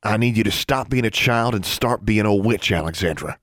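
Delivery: plain reading voice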